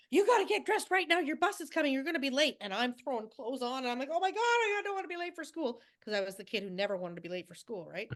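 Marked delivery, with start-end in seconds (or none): 0.1-2.6 put-on hurrying a child, pitched up at the start; mock-urgent mother rousing a sleeper; imitated urgent mom. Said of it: "She's like". 4.1-5.8 high, mock-panicked little kid; retold childhood panic. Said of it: "almost in tears 'cause I was gonna miss my bus".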